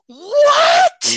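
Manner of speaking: a high, loud shriek of surprise at the heel turn; shocked shout. Retold or imitated. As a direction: cry out in disbelief. Shocked reaction to a wrestling turn.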